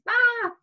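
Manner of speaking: short high mock scream "Ah"; a quick high playful scream for the class; roller coaster scream. Shown as a demonstration. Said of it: "you're kind of like, just like making the noise".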